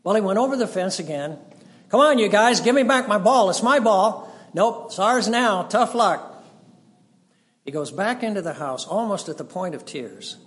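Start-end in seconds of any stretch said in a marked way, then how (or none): 1.9-4.3 loud, high insistent demand; louder, higher boy's demand; boy yelling. Retold or imitated. Boy yells over fence. 4.5-6.4 loud, higher smug taunt; taunting reply. Retold or imitated. Neighbor boys taunt back.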